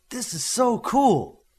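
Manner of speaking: drawn-out delighted gush; awestruck. Acted. Teen looking at new gear.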